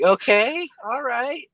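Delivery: high-pitched wary questioning; hesitant acceptance. Retold or imitated. Driver asking injured friend to continue, high wary voice. Gingerly asking for the second thing.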